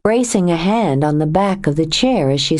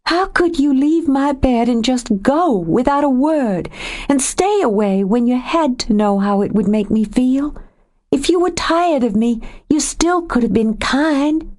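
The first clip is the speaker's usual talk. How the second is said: a higher woman's voice, rising with hurt; Joe, wounded and angry; 8 semitones higher than usual. A narrator's reading. Joe accusing Nathan of leaving, wounded and angry. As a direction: accuse him of leaving her.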